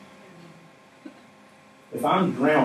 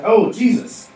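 surprised; 7 semitones higher, slower than usual. Retold or imitated. Disciple spotting sleeping Jesus.

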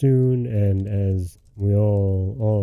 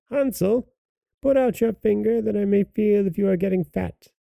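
put-on old woman calling; 9 semitones higher than usual. A narrator's reading. Witch at the stable checking on Hansel, high calling voice. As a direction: call the boy in a high old-crone voice. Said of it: "the old woman hobbled out to the stable and cried".

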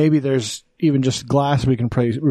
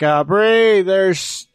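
higher, drawn-out call to a partner; retold calling out; 6 semitones higher, much slower than usual. Homeowner calling partner to the broken door, retold calling out. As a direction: re-enact calling someone over uneasily.

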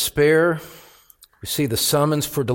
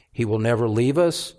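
ordinary talk

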